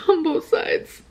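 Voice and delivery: high, slow rueful laugh. Real, not acted. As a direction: laugh it off through tears. Repeats phrase, then laughs.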